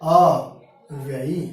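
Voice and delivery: अव्ययी slowly, with a drawn-out opening अ; reading demonstration. Shown as a demonstration. Teacher showing how the अ is read, slow अव्ययी. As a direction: stretch अव्ययी out, starting on a clear अ. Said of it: "अ पढ़ेंगे।".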